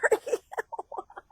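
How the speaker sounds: giggling breathlessly; a high breathy giggle. Real, not acted. Laugh after cue slip.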